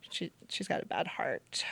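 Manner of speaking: retold in a whisper; hushed. Doctor passing on grim news, whispered.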